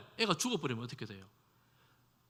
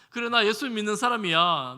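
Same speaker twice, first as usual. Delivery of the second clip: loud, confident, 나 punched hard; proud loud declaration; 5 semitones higher, 8 dB louder than usual. Shown as a demonstration. Believer proudly declaring faith to family, loud and bold. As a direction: proclaim it boldly so all can hear. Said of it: "당당하게 큰 소리 치면서".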